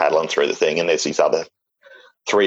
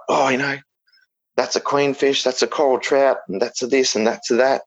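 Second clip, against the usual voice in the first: higher, lightly imitated young fish-spotter; imitating an eager kid; 6 semitones higher than usual. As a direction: rattle off fish names like a keen kid. Imitates nephew naming fish.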